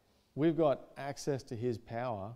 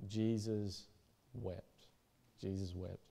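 low, soft and grave; hushed solemnity; 5 semitones lower, 7 dB quieter, slower than usual. Acted. Preacher reads that Jesus wept, hushed and solemn. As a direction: let the verse drop away quietly. Solemn repeat of the shortest verse.

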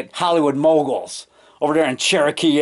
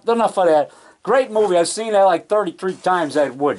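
ordinary talk

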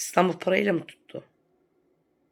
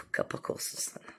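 softer hissed comeback; sneering whisper; 11 dB quieter than usual. Real, not acted. Taunting the rival woman with a whispered put-down.

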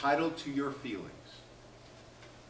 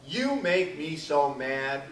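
slow example quote; 3 dB louder, much slower than usual. Shown as a demonstration. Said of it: "And what happens then?".

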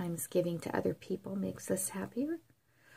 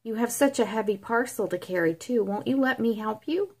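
steady storybook reading voice; story narration; 6 dB louder than usual. A narrator's reading. Grandma reading a Christmas story to grandchildren, storybook voice. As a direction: read it aloud to the grandkids.

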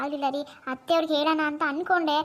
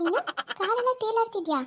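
higher, anxious mother's voice; an alarmed mom, pitched up; worried mother; 8 semitones higher than usual. Acted. Mother questioning her daughter in a higher worried voice.

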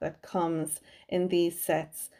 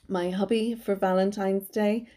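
usual speaking voice

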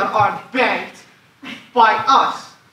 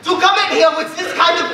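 high furious yell, fast; furious outburst; 7 semitones higher than usual. Acted. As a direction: explode at her. Banker explodes at petitioner.

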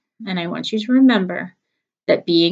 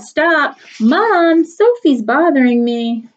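put-on higher, louder kid tattling to mom; yelling; 7 semitones higher, 6 dB louder, much slower than usual. Schubert yelling to mom about his sister. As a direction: yell for mom like a bothered kid. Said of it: "Schubert yelled".

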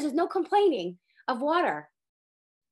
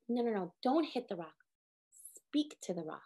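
much softer and lower, gently instructing; quiet divine command; 4 semitones lower, 8 dB quieter than usual. Retold or imitated. God quietly telling Moshe what to do. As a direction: hush it down like a calm command. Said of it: "Hashem tells Moshe".

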